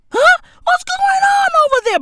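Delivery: bewildered high-pitched call; high yelled question. Pretend. Calling out to Blac Chyna, a high bewildered yell.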